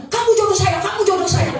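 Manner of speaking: high, hammed-up ecstatic claim; mock fervent declaration. Old bachelor grabbing a woman's hand in worship, mock fervent. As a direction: imitate a zealot claiming a match.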